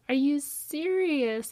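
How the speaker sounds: thrilled incredulous; amazed disbelief. Retold or imitated. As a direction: savor the shock. No deposit, free rent.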